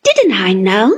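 loud, eager cry; a touch of gleeful shout. A narrator's reading. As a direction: call it out in triumph. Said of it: "cried I".

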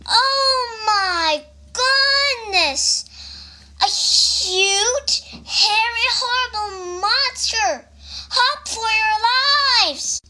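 high, wailed storybook alarm; high-pitched mock-fearful warning; panicked rabbit. A narrator's reading. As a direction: cry out in theatrical panic.

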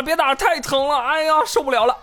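put-on high, whiny kid's plea not to be hit; mock child begging. Retold childhood beating, begging mom. (装小孩哭喊求饶)

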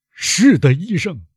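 high, forceful outburst; loud cry. A narrator's reading. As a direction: cry out in anguish, pitch leaping. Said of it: "威勒夫高声地说".

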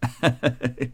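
soft acted chuckle; matriarch's chuckle. A narrator's reading. Matriarch amused by the crab fight, soft chuckle. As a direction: chuckle like an amused old lady. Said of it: "贾母笑道".

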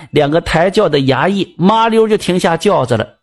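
ordinary delivery, nothing marked

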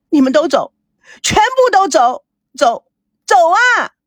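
high, insistent yells; hysterical dismissal. A narrator's reading. Daughter breaking from her mother's embrace, hysterical yelling. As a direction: drive them all out, rising to a last cry. 女儿挣脱怀抱，赶父母走.

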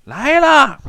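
high, loud answering holler; calling back in answer. A narrator's reading. Fisherman answering a man on the bank, high loud call. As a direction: sing out loudly from the river. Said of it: "那渔翁答应一声".

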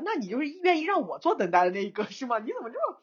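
high-pitched, put-out challenge; mock indignant retort. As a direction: throw it back, half-playfully offended. Acts out indignant unspoken retort. (假装不满地反问)

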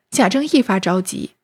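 ordinary delivery, nothing marked

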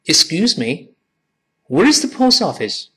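high, clear teacher reading; model question. Shown as a demonstration. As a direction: read it first, high, for the class to repeat. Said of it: "我先读".

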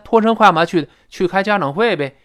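ordinary talk

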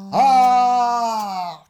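high far-off scream; hit hard crying out. Acted. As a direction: scream as if falling from far away. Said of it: "一声惨叫".

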